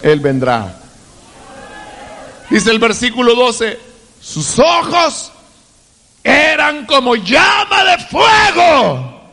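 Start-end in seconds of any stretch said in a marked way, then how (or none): 4.2-9.2 rising, shouted sermon cadence; fiery preaching. Acted. Preacher crying out to the church about the wedding feast. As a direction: preach it out to the congregation.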